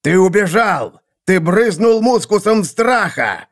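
high, outraged accusation; angry accusation. A narrator's reading. Seer accuses assassin of cowardice, angry.

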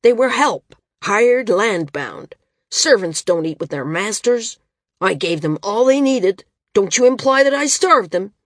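loud indignant defense; bristling, raising his voice; angry. A narrator's reading. Arak angrily defends himself.

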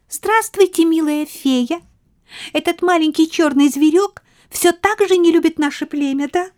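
high, bright queen mouse. A narrator's reading.

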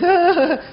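high fake wail; mock sobbing father. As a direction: sob theatrically in mock despair. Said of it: "kita pula nangis".

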